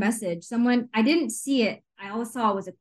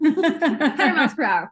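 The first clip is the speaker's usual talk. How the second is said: higher, louder, laughing through it; giggling echo, pitched up; laughing repeat; 6 semitones higher, 4 dB louder than usual. Real, not acted. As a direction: laugh the phrase back at her. Laughing echo of friend's phrase.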